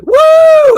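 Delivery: very high, loud mock cheer of woo; imitated woo. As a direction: belt out a high excited woo. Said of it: "He's like".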